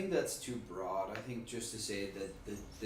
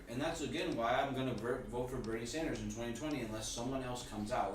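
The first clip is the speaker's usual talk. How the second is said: ordinary talk, nothing marked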